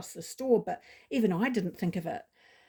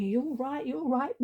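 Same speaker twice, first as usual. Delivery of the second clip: put-on baby talk; 5 semitones higher than usual.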